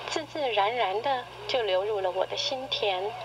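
normal talking voice

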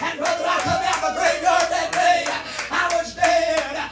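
slow sung climactic preaching; hollering revival preacher. Acted. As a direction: build the whoop line by line. Climbing whooped sermon.

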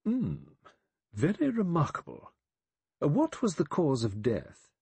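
high, small, precise judge; small, precise, clear voice. A narrator's reading. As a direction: speak small and clear, each word exact. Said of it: "said in his small, precise, clear voice".

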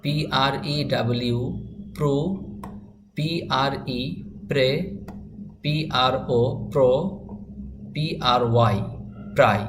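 slow letter-by-letter spelling, then the blended syllable. Shown as a demonstration. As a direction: spell each one aloud, pause, say the sound. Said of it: "লাইনটি আমরা বানান করে পড়লাম".

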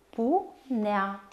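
"punea" split in two: pu, nea; despărțit în silabe. Shown as a demonstration. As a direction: pause between the syllables of "punea". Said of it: "se desparte astfel". (rostit pe silabe, cu pauză)

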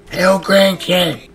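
high, loud, slow call; loud playful calling out. Pretend. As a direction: hail someone from afar. Calling out to grandkid loudly.

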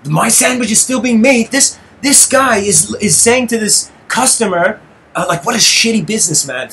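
real exasperated disbelief; getting emotional. Real, not acted. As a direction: let the outrage push your pitch up. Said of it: "I'm getting emotional here".

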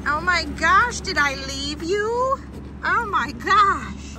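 high, loud startled gasp of realization; sudden alarm at forgetting something; surprised. Real, not acted. Realizes she left something behind.

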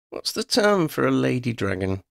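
high, mock-thoughtful question; pondering girl. A narrator's reading. Girl needles dragon, high mock musing. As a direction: wonder aloud with a sting. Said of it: "Lydia pondered".

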